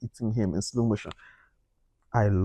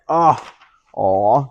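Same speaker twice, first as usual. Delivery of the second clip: touched aw; 7 dB louder than usual. Retold or imitated. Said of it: "I was like".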